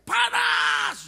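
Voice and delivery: high, loud, drawn-out mock scream; imitated scream. Retelling the possessed girl's cry, high loud scream. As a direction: shriek it out as if burning. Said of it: "waktu dia teriak".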